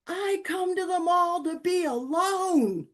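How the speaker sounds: high, loud, slow mock yell; retold yell. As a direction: yell it out like an annoyed old man. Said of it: "and he yelled it".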